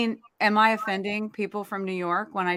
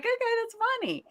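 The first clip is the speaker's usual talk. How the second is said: amused retort; 13 semitones higher, 4 dB quieter, faster than usual. Retold or imitated. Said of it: "I'm like".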